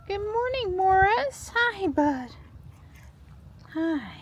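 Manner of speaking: very high cooing voice; pet-talk greeting. Real, not acted. Owner greets Morris her bud, high cooing pet-talk.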